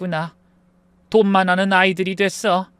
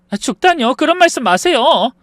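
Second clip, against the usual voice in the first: shocked protest; 9 semitones higher, 6 dB louder than usual. Acted. 죽음 얘기에 놀라 말림.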